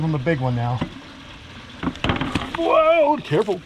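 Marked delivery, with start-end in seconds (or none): 2.6-3.6 high startled whoa; sharp playground warning. Real, not acted. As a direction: warn him off quickly. Lizard grabs big fish.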